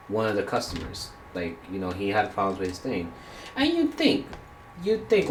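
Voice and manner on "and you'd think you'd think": exasperated outburst. Real, not acted. Ranting about rude customer.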